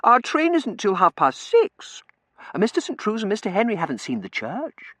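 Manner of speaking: very high, loud wheedle; high-pitched, insistent protest; girlish pleading. A narrator's reading. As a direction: beg your cousin like a spoilt girl.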